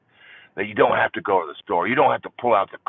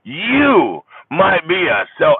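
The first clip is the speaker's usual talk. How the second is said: high, loud, slow comic punchline voice; Jeff Foxworthy; 10 semitones higher, 8 dB louder, slower than usual. Retold or imitated. Jeff Foxworthy doing a stand-up bit, loud and slow. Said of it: "I went Jeff Foxworthy on you".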